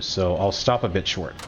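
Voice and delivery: usual speaking voice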